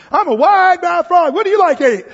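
loud, high, stretched-out joke frog voice; wide-mouth frog. Acted. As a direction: belt it out as a big-mouthed cartoon frog. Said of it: "he goes up to the alligator and says".

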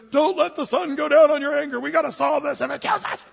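high, loud mock shout mid-argument; mock yelled quote. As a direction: shout it like a spouse in a fight. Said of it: "somebody says".

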